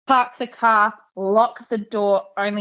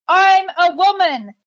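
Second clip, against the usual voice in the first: higher, slow, pointed sarcasm; sarcastic comeback; 9 semitones higher, 3 dB louder, slower than usual. Retold or imitated. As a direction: spell it out slowly like he is dim.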